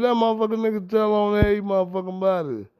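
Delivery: loud, heated shout; angry shout. Retold or imitated. Ranting about the snitch in the group, loud angry shout. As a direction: yell it out in disgust.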